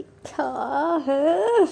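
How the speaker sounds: drawn-out exasperated whine; irritated. A narrator's reading. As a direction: stretch it out in annoyance. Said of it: "Nandini अब irritate हो रही थी". (चिढ़कर खींचते हुए)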